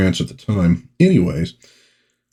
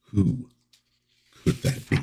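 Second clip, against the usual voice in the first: hushed tease; 10 dB quieter than usual. Pretend. Teasing the hidden villain.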